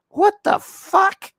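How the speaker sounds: high, slow, incredulous what the fuck; disbelieving outburst. Retold or imitated. Reacting to her laughing at Indy, high stunned disbelief. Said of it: "I'm like".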